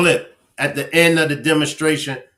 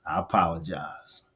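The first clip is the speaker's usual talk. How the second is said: sincere apology; 9 semitones lower, 12 dB quieter than usual. Real, not acted. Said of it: "I'm sorry, y'all".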